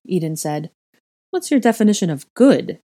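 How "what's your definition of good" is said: higher, louder challenge; indignant cry. A narrator's reading. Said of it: "Helga cried".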